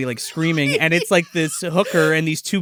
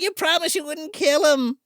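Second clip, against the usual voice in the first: higher mock-plaintive mother voice; lightly voiced mother pleading; pleading mom; 8 semitones higher than usual. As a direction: play the mom begging the vampire. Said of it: "she's like".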